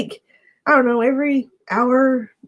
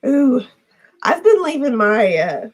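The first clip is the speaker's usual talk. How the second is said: usual speaking voice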